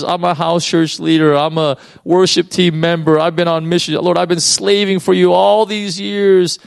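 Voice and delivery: hammed-up whine of a believer who feels overlooked; whiny complainer. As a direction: ham up a jealous believer griping at God. Voicing a bitter complaining believer.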